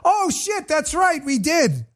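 high-pitched exclamation, falling away; hammed-up "oops, I forgot" outburst; mock sudden realization. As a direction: blurt it high, as if just caught out. Mocking opponents' sudden realization.

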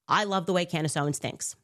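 normal talking voice

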